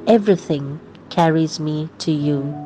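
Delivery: ordinary delivery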